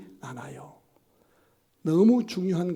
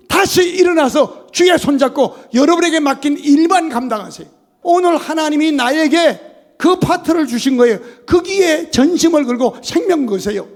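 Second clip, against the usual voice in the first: loud, higher, driving sermon voice; fervent preaching; 9 semitones higher, 12 dB louder than usual. Acted. Pastor warns the congregation, loud fervent preaching. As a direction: preach it out with full force.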